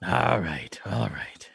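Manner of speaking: low whispered all right; whispering. Acted. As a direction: whisper it softly, in character. Player whispers in character.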